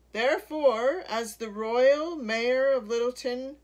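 loud official proclamation; booming official declaration; pompous mayor. A narrator's reading. Mayor makes official demand.

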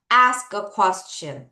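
very slow, every word spaced out; slow model phrase. Shown as a demonstration. Teacher modelling a phrase slowly for pupils to repeat. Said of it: "자, 따라해볼까요?".

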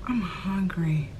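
slow, soft whispered confession; hushed, drawn-out mock admission; acted whisper. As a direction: whisper it slowly, as if sheepish. Sheepishly telling husband right after eating.

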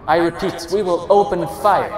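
repeated loudspeaker warning. A narrator's reading. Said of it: "the officer declares".

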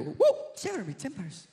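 ordinary delivery

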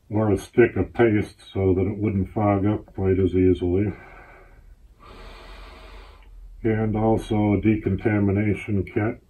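muffled behind rubber mask; through a gas mask. Real, not acted. Seen 1: Speaker wears full rubber gas mask over face throughout labelled words.

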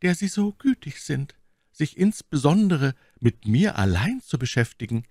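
hoch gesprochene Mylady, misstrauisch. A narrator's reading. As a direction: als misstrauische Lady, deutlich höher. Voiced Milady. (Mylady fragt den Offizier misstrauisch, hohe Stimme)

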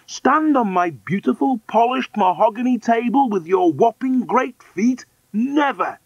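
high, loud indignant tirade; shrill, affronted wife; outraged cry. Acted. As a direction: scold in a scandalised high voice. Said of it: "cried the woman".

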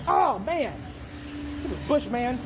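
normal talking voice

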